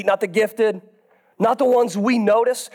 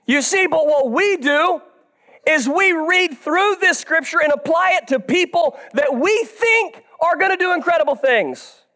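high-pitched, driving sermon delivery; emphatic preaching; 8 semitones higher than usual. Acted. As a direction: preach it out to the whole room. Preacher building his point with force.

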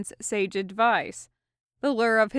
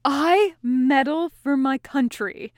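slow, louder, higher proud declaration; grandly, louder and slow; passionately; 5 semitones higher, 6 dB louder, slower than usual. A narrator's reading. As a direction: proclaim it like a patriot. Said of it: "Hawk continued passionately".

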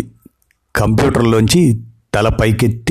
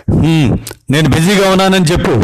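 higher, impatient brush-off; irritated; 7 semitones higher, faster than usual. Acted. Husband tells wife to put off grandma, irritated. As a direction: wave her off, annoyed. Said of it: "చిరాగ్గా అన్నాడు".

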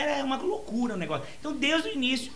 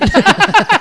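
much louder and higher, bursting into laughter mid-line; papa rabbit's hurried greetings dissolving into loud laughter; laughing yell; 9 semitones higher, 17 dB louder than usual. Acted. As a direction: shout the greeting while cracking up.

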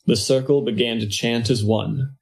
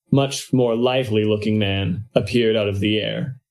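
ordinary talk

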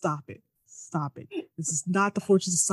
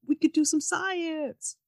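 higher, slow goofy plan; mock hero idea; 9 semitones higher, slower than usual. Imitating the hero's plan, high slow mock eureka. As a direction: draw out the silly idea. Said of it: "he's like".

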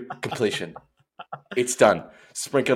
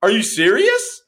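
high, louder squeal of disbelief; amazed disbelief; 5 dB louder, slower than usual. Retold or imitated. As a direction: gasp it out in wonder. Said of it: "I'm like".

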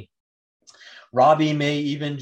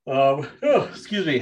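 yawning; 3 dB louder, slower than usual. Real, not acted. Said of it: "sorry, yawning".

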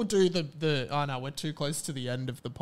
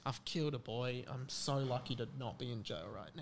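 hushed weary dragon; 6 semitones lower, 11 dB quieter than usual. Retold or imitated. Voicing the dragon driving home.

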